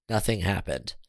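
plain reading voice

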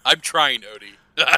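loud outburst of frustration; exasperated protest. Real, not acted. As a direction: protest loudly at the bad luck. Player protests after bad rolls.

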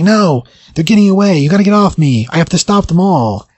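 high, urgent hero's cry, acted out; retold desperate protest. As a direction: protest as if held back from the chase. Hero protests as enemies escape.

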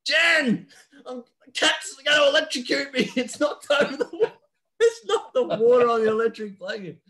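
high, loud, panicked dad calling to his wife; hammed-up high-pitched dad screaming to his wife; mock panicked dad. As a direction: yelp it as a frightened father, laughing through it. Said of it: "screams out to Mum".

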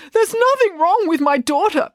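high, insistent protest. A narrator's reading. Dad protests to the doctor, high and insistent. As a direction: push back hard for his daughter. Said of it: "protested Dad".

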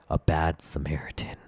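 low, whispered punchline; hushed, conspiratorial aside; mock-ominous hush.

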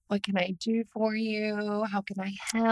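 slow, soft bedside manner; lightly voiced caring doctor; sincere doctor voice. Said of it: "very sincere sounding Doctor Act".

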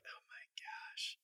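slow, breath-only whisper; barely audible retold inner groan; dreading whisper. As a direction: whisper it privately in disbelief. Retold silent dread at being followed.

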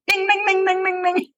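high sing-song ding ding; playful high bell imitation; imitated bell ding. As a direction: chime it like a quiz bell. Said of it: "he was like".

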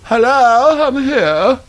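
high, far-off silly voice; high put-on voice. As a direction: squeak it out high as a newcomer. Mock arrival voice, laughs after.

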